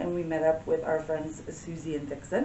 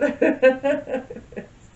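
bright laugh; 8 semitones higher, 7 dB louder than usual. Real, not acted. Boss's remark made her laugh.

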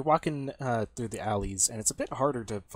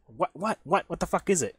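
higher, louder, repeated annoyed demand; irritated questioning; 8 semitones higher, 5 dB louder than usual. Acted. Smacked bar patron to his friend, irritated questioning. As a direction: demand to know why you were smacked.

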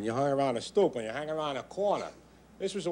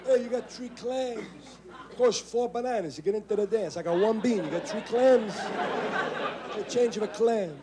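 Italian street guy; 10 semitones higher than usual. Retold or imitated. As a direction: haggle like a street guy, laughing. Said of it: "Money was measured in bananas and clams".

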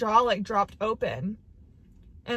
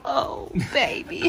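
slow breathy coo; doting coo; 4 semitones higher, 6 dB louder, slower than usual. Real, not acted. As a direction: coo at the dog. Comforting her dog.

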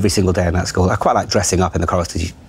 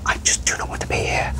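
whispered inner thought, much softer; 6 dB quieter than usual. Retold or imitated. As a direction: breathe it out unseen, under your breath. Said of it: "just going".

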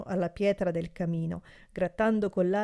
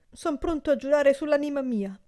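high, louder eager vow; eager oath; 8 semitones higher, 5 dB louder than usual. A narrator's reading. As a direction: swear it fervently. Servant swears eagerly.

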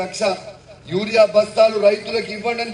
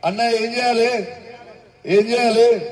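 raised rallying question; calling to crowd; 2 semitones higher than usual. Real, not acted. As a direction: call out for the crowd's answer.

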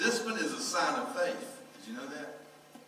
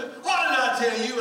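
high, loud, slow scold; exasperated mock yell; scolding shout; 10 semitones higher, 9 dB louder, slower than usual. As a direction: bark it at the kid. Son scolding again.

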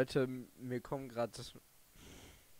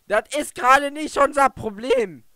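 angry outburst; 11 semitones higher, 15 dB louder than usual. Real, not acted. Angry at the segment.

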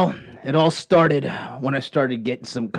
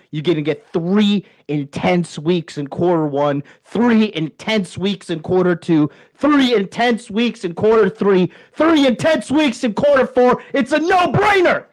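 building hype; 5 semitones higher than usual. Acted. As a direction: pitch climbs higher each line. Pitch builds to climax.

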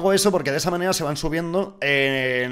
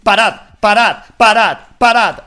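higher, loud desperate stop; loud pleading; 7 semitones higher, 12 dB louder, slower than usual. Real, not acted. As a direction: yell for them to quit shooting. Caught in crossfire. (gritos de súplica)

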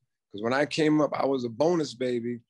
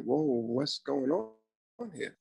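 bewildered; 2 semitones higher, 5 dB quieter, much slower than usual. Retold or imitated. Retells bafflement at household mood swings.

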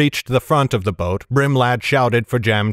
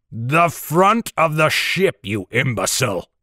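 roaring captain; 4 semitones higher than usual. A narrator's reading. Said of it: "roared Brimlad".

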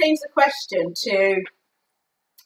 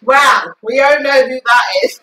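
teasing shout; 3 semitones higher, 8 dB louder than usual. Real, not acted. Teasing shout about becoming famous.

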